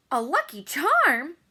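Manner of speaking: high, loud, slow surprised shout. A narrator's reading. Girl shouting in surprise at the lucky charm news. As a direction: blurt it out in disbelief. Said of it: "she shouted".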